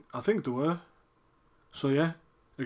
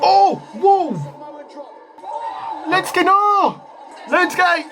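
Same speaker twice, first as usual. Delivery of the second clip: frantic high-pitched fan yells; alarmed shouting; 11 semitones higher, much slower than usual. Real, not acted. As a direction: scream for your favourite in peril. Fan alarmed for favourite wrestler.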